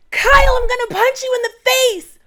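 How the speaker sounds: shrill-high, loud mock screech of Amanda's threat. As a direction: ham up Amanda snapping a threat. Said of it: "She says".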